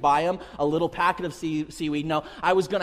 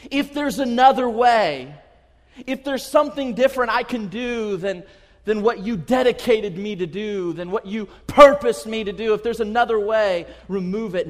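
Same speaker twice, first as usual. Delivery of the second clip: raised, urgent, forceful plea; pleading prayer; 3 semitones higher, 7 dB louder than usual. Acted. Jesus begging his Father in Gethsemane, urgent.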